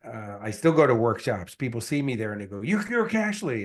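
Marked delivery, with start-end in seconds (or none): 2.6-3.6 high, amazed "you're Cashley"; a stranger startled to spot a celebrity; surprised recognition. Retold or imitated. Workshop attendee spotting him, surprised recognition.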